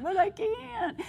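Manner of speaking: high whining complaint; whiny protest. Retold or imitated. Speaker to God about pregnancy at forty-two, in a high whine. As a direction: plead like a reluctant child. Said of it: "I'm like".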